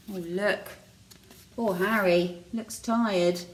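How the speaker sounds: ordinary delivery, nothing marked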